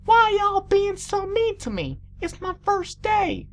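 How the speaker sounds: high, drawn-out Southern whine; hurt Southern filly. A narrator's reading. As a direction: protest in a Southern twang. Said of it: "with a strong southern accent".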